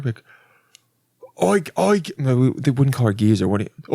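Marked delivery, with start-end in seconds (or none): 1.4-2.0 high Cockney hail. Acted. Cockney guy getting a lady's attention, broad accent. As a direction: call out in mock Cockney. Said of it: "the Cockney guy tried to talk to her".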